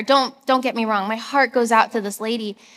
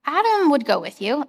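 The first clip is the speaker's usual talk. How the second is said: higher, brightly helpful suggestion; upbeat Shane; 5 semitones higher than usual. Retold or imitated. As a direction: toss out the idea eagerly, a lightly voiced friend. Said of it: "And he's like".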